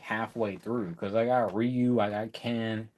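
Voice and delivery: ordinary talk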